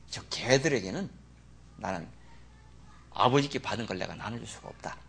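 low, soft, dismissive voice; cold quiet refusal. Acted. Jesus to his disciples, a low cold refusal. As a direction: turn her away in a hushed cold tone.

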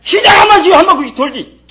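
high, loud burst of rebuke; real scolding. Real, not acted. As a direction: shout it at the congregation. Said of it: "그래서 지금 호통치는 거예요".

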